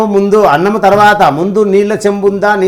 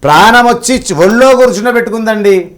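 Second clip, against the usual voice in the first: raised, louder preacher's voice, full of wonder; fervent preaching; 5 semitones higher, 5 dB louder than usual. Acted. Devotee tells the host of the miracle, preaching loudly. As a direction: proclaim the miracle to the crowd.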